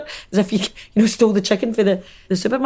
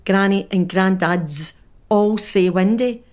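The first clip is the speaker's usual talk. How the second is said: ordinary delivery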